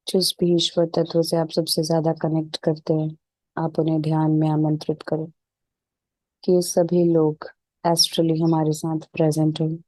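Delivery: even, unhurried meditation voice with little pitch movement; settled, level relaxation-session delivery; guided meditation. Real, not acted. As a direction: guide them calmly into stillness, leaving space between phrases.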